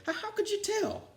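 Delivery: very high, put-on foolish voice; the unmasked principal asking how the team knew; sheepish principal. A narrator's reading. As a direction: sound caught out and embarrassed. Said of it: "said Blansworth".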